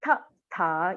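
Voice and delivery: ta with the tongue touching the teeth; teacher demo of dental ta. Shown as a demonstration. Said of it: "the tongue touching the teeth".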